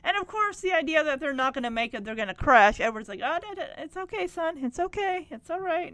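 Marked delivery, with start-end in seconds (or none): 3.2-5.9 soothing. Retold or imitated. Father soothing son.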